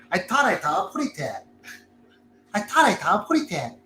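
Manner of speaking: lightly voiced Porky Pig lisp; a hint of the cartoon pig, slurred; Porky Pig impression. Host imitates daughter doing Porky Pig, light cartoon lisp. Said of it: "almost like Porky Pig, like, she starts stuttering".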